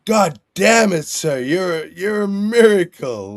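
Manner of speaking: high, loud, astonished drill sergeant; amazed yell. Retold or imitated. Drill sergeant yelling at the cadet in amazement, high and loud. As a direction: shout it like he's seen a miracle.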